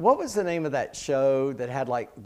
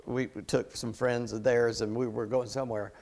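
ordinary talk

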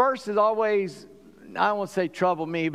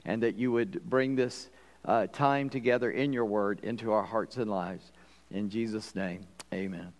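lower, measured praying voice; public prayer; 6 semitones lower than usual. Real, not acted. Pastor thanks the Lord for the congregation, low measured prayer. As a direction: pray aloud in low tones with unhurried pauses.

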